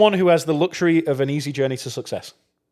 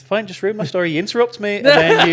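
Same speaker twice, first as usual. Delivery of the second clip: huffy fake outrage, pitched up; mock offended; 6 semitones higher, 3 dB louder than usual. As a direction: play at being hurt.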